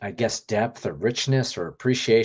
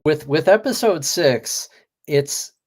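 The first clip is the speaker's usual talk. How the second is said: excited jump-in; 7 semitones higher, 8 dB louder than usual. Real, not acted. Co-host jumps in after laughter.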